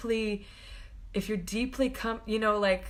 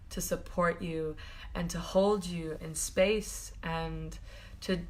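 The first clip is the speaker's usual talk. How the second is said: ordinary delivery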